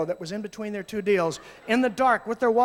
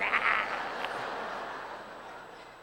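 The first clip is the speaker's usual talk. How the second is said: breathy laugh; 4 dB quieter than usual. Real, not acted. Breathy laugh after quote.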